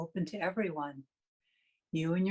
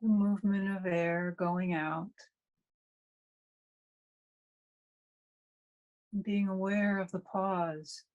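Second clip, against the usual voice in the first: guided meditation. Real, not acted. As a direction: guide them through breath awareness, leaving long silences between phrases.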